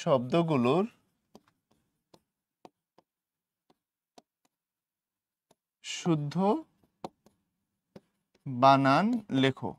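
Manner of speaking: slow dictation with long pauses. Real, not acted. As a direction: dictate in pieces, softly, waiting while it is written. Dictates while writing, long gaps.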